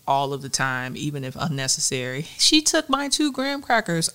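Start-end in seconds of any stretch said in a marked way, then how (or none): 2.4-4.1 put-on high whiny kid complaint; whiny child. Mother imitates her child tattling, high whiny voice. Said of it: "a five-year-old and a two-year-old".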